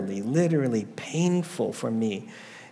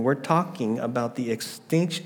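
ordinary delivery

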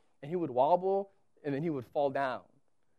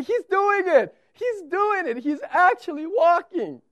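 much higher, louder delighted cries; thrilled dad; 12 semitones higher, 9 dB louder than usual. Retold or imitated. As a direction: burst out with joy at the first steps. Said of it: "And then I say".

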